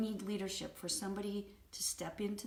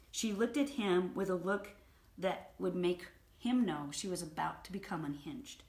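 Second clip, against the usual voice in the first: normal talking voice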